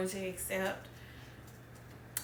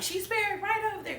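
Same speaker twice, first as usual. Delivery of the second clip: higher, breezy mom voice, lightly voiced; tossing it off like it's nothing; imitating her mom; 11 semitones higher than usual. Mimicking her mom answering from the car, high and offhand. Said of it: "my mom's like".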